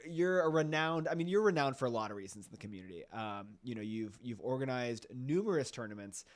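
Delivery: ordinary delivery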